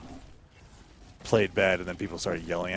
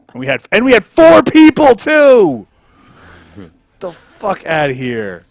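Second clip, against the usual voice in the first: very loud, much higher rage; furious outburst, last words slowed; furious shout; 10 semitones higher, 21 dB louder, slower than usual. Real, not acted. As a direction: scream at the screen. Raging at game.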